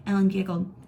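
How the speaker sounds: ordinary delivery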